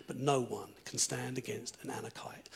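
hushed, fearful whisper. Retold or imitated. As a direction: breathe it like a doubt creeping in. Voice of fear quoted.

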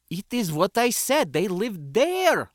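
high-pitched, exasperated outburst; bursting out. A narrator's reading. Foreign boy insisting to a confused visitor, bursting out. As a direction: blurt it out, stretching the last words. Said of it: "the boy burst out".

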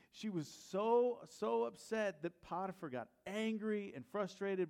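ordinary delivery

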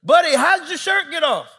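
loud, high-pitched parental holler; exasperated yell. Retold or imitated. Dad questioning his son upstairs, exasperated yell.

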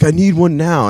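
high, loud mock demand; urgent insistence. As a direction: imitate an impatient wrestler insisting. Retold wrestler insisting on a towel.

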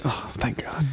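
whispered relief. Real, not acted. Relieved aside.

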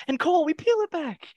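high, loud, climactic reveal; dramatic climax. Pretend. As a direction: peak the suspense.

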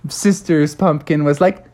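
ordinary delivery, nothing marked

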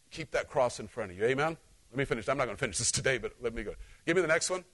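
normal talking voice

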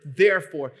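the preacher driving home "therefore", loud; stressed therefore. Acted. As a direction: land hard on "therefore". Preacher stresses key word.

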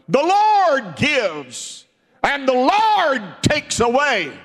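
loud, high, slow climb to Blessed; loud preached praise. Acted. Preacher as Job proclaiming blessed be the Lord, loud and slow. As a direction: cry it out as a declaration of faith.

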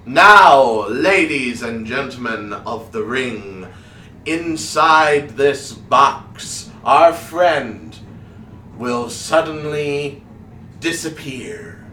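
slow, drawn-out announcer voice, opening loud; theatrical stage magician. Acted. Wizard announcing the vanishing trick to the ring, slow and theatrical. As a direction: stretch each phrase for suspense.

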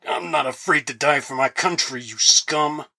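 defiant senator, spitting the words; understated contempt through a strained hold; spat out. A narrator's reading. Senator held in a wrestling grip, spitting defiance. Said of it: "Good spat".

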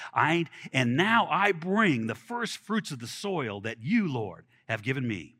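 slow, measured reading of the passage; scripture reading. Acted. Preacher reading scripture to the congregation, measured. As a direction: read the verses out with weight.

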